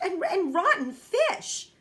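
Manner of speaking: disgusted stammer with a laugh breaking through; gasping. A narrator's reading. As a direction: sputter it out, nearly gagging. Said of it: "she gasped".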